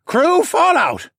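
high, loud, drawn-out order; shouted command. A narrator's reading. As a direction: call out across the whole ship. Captain calls order to crew.

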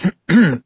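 a short, pointed throat clear before speaking. A narrator's reading. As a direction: clear the throat to get attention. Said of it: "Bill cleared his throat".

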